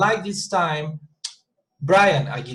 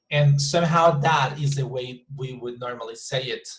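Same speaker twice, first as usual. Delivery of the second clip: normal talking voice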